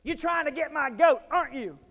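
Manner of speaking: high, loud mock yell of an angry teacher; mock scream. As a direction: shriek it like a teacher losing it. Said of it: "he screams in my face".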